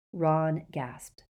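ordinary delivery

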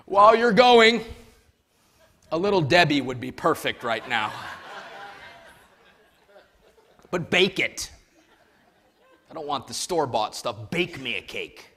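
hammed-up bossy prophet; comic prophet. Pastor joking about the prophet's cake order, hammed-up demand. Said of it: "I don't know why that hits me so funny".

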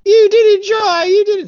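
put-on shrieking accusing children; kids yelling. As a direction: yell it like upset kids. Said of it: "the kids are like".

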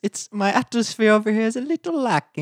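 high-pitched whiny British lord imitation. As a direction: whine it in a put-on British accent. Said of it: "you sound a little whiny".

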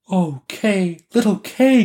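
put-on high little girl's voice; Gerda lamenting. A narrator's reading. Gerda longs for little Kay, high-voiced lament. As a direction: call the name in a small, high voice. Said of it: "Gerda said".

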